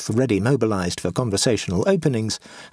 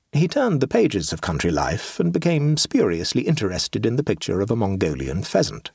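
ordinary delivery, nothing marked

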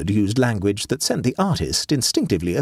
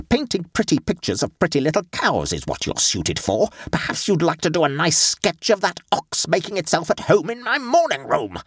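high, sarcastic indignation, climbing to the end; mock his talents, voice rising in outrage; cutting sarcasm from an outraged lady; scathing retort; 8 semitones higher than usual. A narrator's reading. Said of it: "retorted the lady".

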